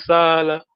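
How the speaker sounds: "Sala" with a long held first vowel; long-vowel demonstration. Shown as a demonstration. Teacher models the word for staying, long-vowel demonstration. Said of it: "make sure you got the long vowel".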